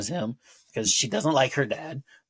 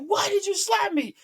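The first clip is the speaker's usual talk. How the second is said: much higher, whiny complaint; indignant squeak. Retold or imitated. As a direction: squeal the question in a high, offended voice. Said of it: "He's like".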